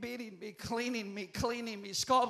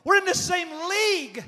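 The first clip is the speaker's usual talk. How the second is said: shouted repeat; 7 semitones higher, 7 dB louder than usual. Real, not acted. Restating the point, louder.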